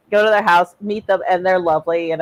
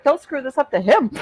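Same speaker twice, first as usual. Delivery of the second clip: grandparents warning; 8 semitones higher than usual. Retold or imitated. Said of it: "They're like".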